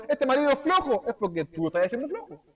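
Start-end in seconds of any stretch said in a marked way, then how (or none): 0.1-1.0 reclamo agudo y burlón; esposa quejosa. Retold or imitated. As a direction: imitando a una esposa que alega. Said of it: "la mujer alega". (esposa que alega por su marido, tono agudo)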